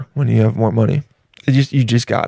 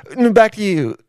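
high, moaning reporter sign-off; flustered reporter; 7 semitones higher than usual. Retold or imitated. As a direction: hand back while mock-swooning. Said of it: "they're like".

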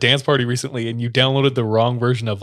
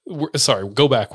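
ordinary talk, nothing marked

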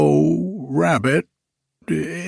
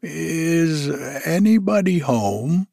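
higher call into the hole; 6 semitones higher than usual. Acted. Pooh with head in the hole, calling out. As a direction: call out into the burrow. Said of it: "called out".

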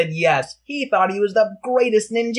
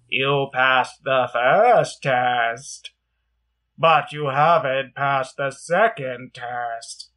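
slow low wise teacher; 5 semitones lower, much slower than usual. Acted. Hidden teacher speaks.

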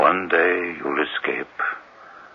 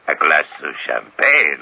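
usual speaking voice

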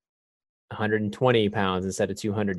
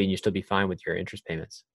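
ordinary talk, nothing marked

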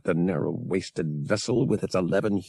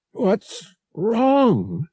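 high, demanding question; 11 semitones higher, 3 dB louder than usual. A narrator's reading. Passenger as the engine strains, demanding. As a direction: demand an answer at once. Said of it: "Marav demanded".

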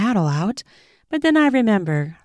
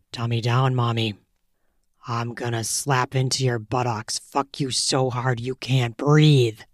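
dominant lover; 6 semitones lower than usual. A narrator's reading. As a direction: growl the order low and close. Male lover voiced low.

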